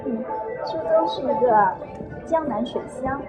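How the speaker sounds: ordinary talk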